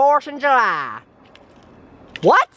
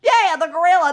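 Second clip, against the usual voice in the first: usual speaking voice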